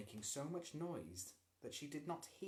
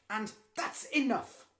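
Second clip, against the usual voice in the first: stern; 11 semitones higher, 13 dB louder than usual. A narrator's reading. Mother bangs table.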